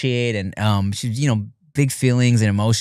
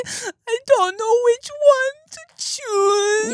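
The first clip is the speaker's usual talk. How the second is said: much higher, slow, fake-crying little girl; whiny crying kid; much slower than usual. Daughter unable to pick a lollipop, mock sobbing high. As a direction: wail it like an overwhelmed preschooler.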